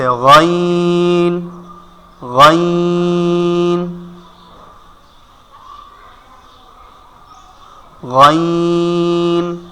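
very slow ghayn from the back of the throat; letter demo. Shown as a demonstration. Teacher drills গাইন very slowly for students. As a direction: drag গাইন out long. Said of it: "গাইন কোথা থেকে".

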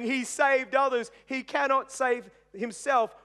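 preacher echoing the mockers; mocking jeer. A narrator's reading. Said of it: "people were mocking him".

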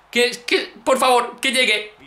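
ordinary talk

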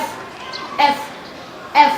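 F ending in a puff strong enough to move paper; F airflow demo. Shown as a demonstration. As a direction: blow air out on F. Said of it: "ทีนี้ครูดูทำใหม่อีกครั้งหนึ่ง". (สาธิตเสียง F พ่นลมให้กระดาษไหว)

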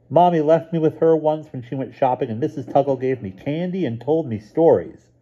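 a cheerful little brother chirping; little boy. A narrator's reading. Stevie at breakfast telling his sister, in a high child's voice.

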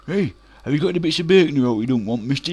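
fast, loud cheeky lad asking; high, quick street kid voice. Retold or imitated. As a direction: rattle it off like a cocky urchin. Kids cheekily asking the butcher.

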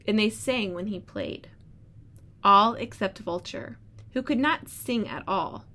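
storybook read-aloud voice; reading to children. Acted. Teacher reads story aloud.

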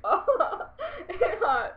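high, loud laughing wail; cracking up, voice pushed up and loud. Real, not acted. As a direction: wail it through real giggles. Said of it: "I don't know why I'm laughing".

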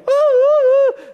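very high, loud teasing woo-hoo; playful child hoot. Retold or imitated. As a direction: hoot like a hiding child giving herself away. Girls calling from hiding.

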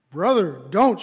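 a touch of loud pleading; mild cry of protest. A narrator's reading. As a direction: read as an understated cry, stressed on each word. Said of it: "she cried".

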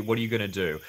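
usual speaking voice

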